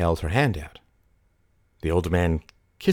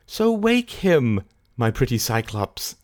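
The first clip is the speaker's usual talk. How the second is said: coaxing; 6 semitones higher, slower than usual. A narrator's reading. Mary wheedles and bribes the keeper.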